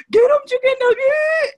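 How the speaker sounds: high, loud laughing yell; squealed through laughter; retold yell. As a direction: yell it like a friend egging him on. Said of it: "his friend yells".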